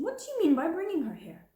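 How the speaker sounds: high, loud, fast scolding question; indignant demand. Acted. Indignant scolding. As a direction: headmistress to Sarah over the doll, indignant and loud. Headmistress scolds orphan girl.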